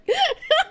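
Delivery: giggling at her own joke; high burst of laughter. Real, not acted.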